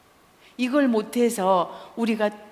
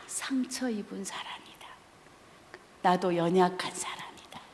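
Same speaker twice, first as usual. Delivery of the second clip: soft whispered confession; emphatic whisper; 2 semitones lower, 7 dB quieter than usual. Real, not acted. Sharing the key lesson with the audience, emphatic whisper. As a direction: breathe the words to the hall.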